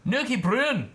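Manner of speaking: put-on over-the-top Scottish accent. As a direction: belt out "Nookie Broon" in a cartoon Scottish accent. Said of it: "I wish I could do a good Scottish accent".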